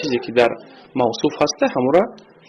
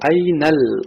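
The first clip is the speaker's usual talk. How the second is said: ordinary talk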